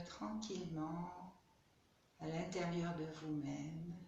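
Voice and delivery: soft meditation voice with long pauses; guided meditation. Real, not acted. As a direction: guide them gently inward, leaving space to breathe.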